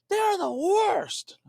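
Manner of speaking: high, strained, drawn-out mock cry; retold outburst. Retired lawyer venting about the regulator, imitated outburst. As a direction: imitate an old man venting, long loud words. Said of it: "he just lays out".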